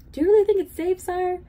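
high-pitched, urgent question; anxious questioning. A narrator's reading. Bartholomew questions the king's order, anxious and high. Timid servant questions the king.

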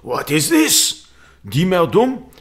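put-on loud, high French-accented disbelief. French opponent faced with an unknown gambit, incredulous French accent. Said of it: "in a French accent he says this".

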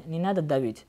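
ordinary delivery

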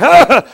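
loud, high burst of fervour; sudden revved-up yell. Acted. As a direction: yell as if overdrive just kicked in. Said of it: "it kicks in overdrive".

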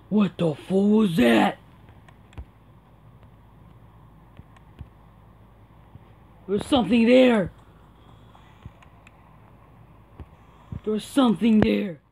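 loud, startled exclamation; loud, frightened disbelief; alarmed shout. Real, not acted. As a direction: shout in alarm at a sudden sighting. Player spots something frightening in game.

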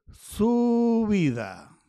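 very slow, drawn-out word; slow dictation while writing. Real, not acted. Writing the word on the chart, stretched out slowly. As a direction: stretch it as you write each letter. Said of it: "así se escribe subida".

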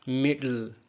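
मिडिल with D going straight into L; no vowel between D and L in मिडिल; immediate L. Shown as a demonstration. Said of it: "एल का sound फौरी तौर पे". (मिडिल में डी के फौरन बाद एल)

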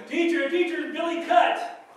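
put-on high, loud kid tattling to the teacher. Child in line calling to the teacher, high and loud. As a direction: squeal it like a child telling on Billy.

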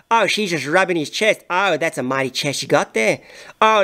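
normal talking voice

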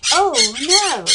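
loud, high "oh no" of panic; alarmed cry. Acted. Parrot wakes the sleeping rivals, loud panicked cry.

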